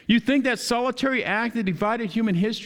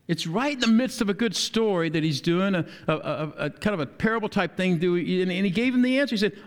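ordinary delivery

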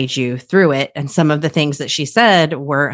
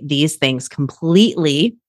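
ordinary delivery

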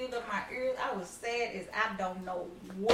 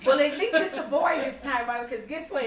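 laughing; 3 semitones higher, 6 dB louder than usual. Real, not acted. Retelling with laugh.

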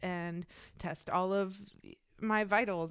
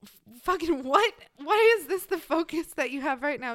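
louder, higher disbelief; mock-incredulous paramedics, louder; 7 semitones higher, 8 dB louder than usual. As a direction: shout it in disbelief. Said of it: "they were like".

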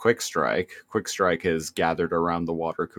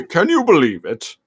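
higher mocking villain echo; Megatron; 8 semitones higher, 5 dB louder than usual. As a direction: sneer it like the robot tyrant. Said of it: "Megatron was listening".

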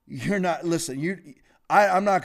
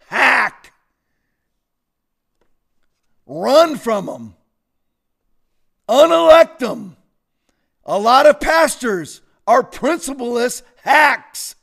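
loud, slow preaching shout; shouting preacher; 5 semitones higher, 6 dB louder, slower than usual. Real, not acted. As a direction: hammer it like a preacher. Preaching at full force.

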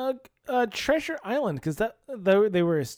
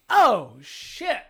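louder, higher mock oh-shit; mock panic; 6 semitones higher, 10 dB louder than usual. Voicing the lab computer, loud mock panic. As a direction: blurt it like a startled machine.

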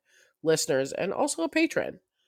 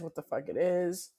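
croaky, hoarse voice; frog voice; 3 semitones lower, slower than usual. Real, not acted. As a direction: talk through a croaky throat. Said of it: "I sound like a frog".

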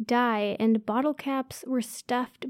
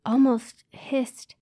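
ordinary delivery, nothing marked

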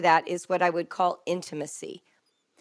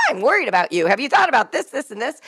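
anxious, pressing parent, a bit louder; worried parent voice; 4 semitones higher, 7 dB louder, faster than usual. Retold or imitated. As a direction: lean in like a fretting parent. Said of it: "We're like".

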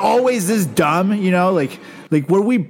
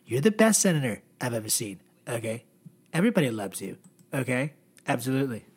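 lower, softer Trump voice with okays; Trump impression; 5 semitones lower, 5 dB quieter, faster than usual. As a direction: boast low and smug like Donald. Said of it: "Then Donald's like".

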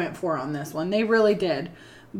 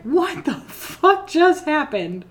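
higher, slow bewildered question; baffled viewer; 7 semitones higher, slower than usual. Retold or imitated. Host voices a confused audience, slow and bewildered. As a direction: drag it out, lost and confused. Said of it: "This one is like".

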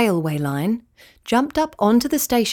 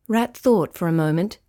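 ordinary delivery, nothing marked

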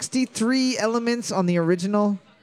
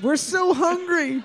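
high, thin bird voice begging; trapped bird voice; 10 semitones higher than usual. Pretend. Trapped Tower ravens calling for help in a high bird voice. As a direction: squeak it like a caged bird.

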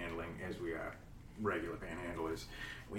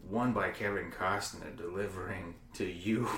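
normal talking voice

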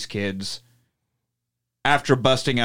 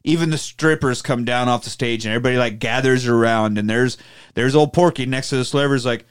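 ordinary delivery

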